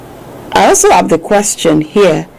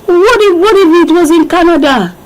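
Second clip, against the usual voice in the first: very high, squeaky teasing voice; pitched-up teasing question; playful objection; 11 semitones higher than usual. Pretend. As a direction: pipe it up in mock protest.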